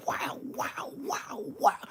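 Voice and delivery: breathy high whispered wows; hushed awed wows. Retold or imitated. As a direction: whisper in awe at her. Admiring a girl.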